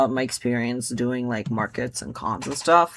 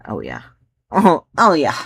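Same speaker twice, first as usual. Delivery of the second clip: normal talking voice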